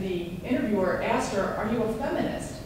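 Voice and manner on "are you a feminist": ordinary talk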